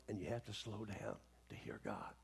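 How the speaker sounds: hushed whisper, fading to almost nothing on the end. Shown as a demonstration. Preacher whispering to his congregation about slowing down to hear God, hushed. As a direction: draw them in with a whisper. Urging the church to be still.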